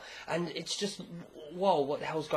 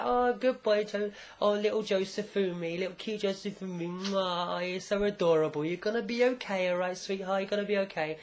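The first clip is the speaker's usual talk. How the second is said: put-on higher-pitched, gushing baby-talk mother; mock doting coo; 5 semitones higher than usual. Holly turns to little Josefumi, doting coo. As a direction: coo over an adorable little child, play-acting. Retold Holly cooing over child.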